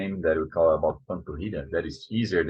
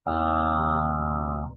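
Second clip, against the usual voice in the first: ordinary delivery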